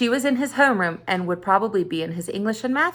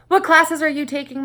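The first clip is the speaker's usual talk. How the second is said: scowling; 7 semitones higher, 5 dB louder than usual. A narrator's reading.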